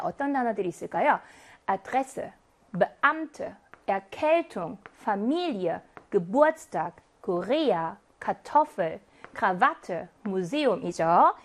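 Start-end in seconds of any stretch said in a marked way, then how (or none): 1.7-11.3 each word read lower, the middle syllable pushed; second-syllable stress. Shown as a demonstration. Teacher reading three-syllable words for students, stressing the middle. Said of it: "삼 음절 이상인데 이 음절에 강세가 온다".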